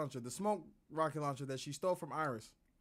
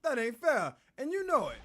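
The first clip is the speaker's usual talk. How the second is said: higher and louder, protesting the price; indignant street girl; 6 semitones higher, 6 dB louder than usual. Acted. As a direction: bristle at being cheated. Girl argues with pawnbroker.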